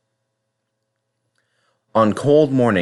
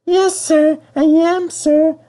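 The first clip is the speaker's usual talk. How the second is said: much higher, timid little engine voice; meek Percy; 3 dB louder, slower than usual. Acted. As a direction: answer small and guilty. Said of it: "a muffled voice replied".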